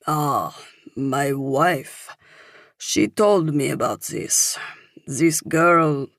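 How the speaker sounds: put-on older Frenchman recalling, halting English; French-accented, in short separated phrases; thick French accent. A narrator's reading. Older man recognizes the girl in the paper, thick French accent. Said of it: "he says with a thick French accent".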